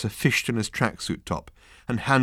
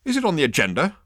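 higher-pitched curt interruption; snapping; 8 semitones higher, 3 dB louder, faster than usual. A narrator's reading. Interrupted man challenges Ford, snapping. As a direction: snap the question at the interrupter. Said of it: "snapped the man".

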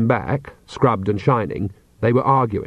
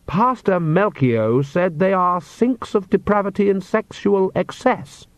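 put-on higher, slow, timid young man's voice; nervous young man; 8 semitones higher, slower than usual. A narrator's reading. Oates nervously tells the witches what his pastor said. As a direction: gulp it out, scandalised and shy.

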